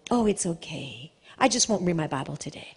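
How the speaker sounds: low, soft mock self-excusing voice; mock excuse. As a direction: lightly mimic a lazy excuse-maker. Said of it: "mocking a compromising believer".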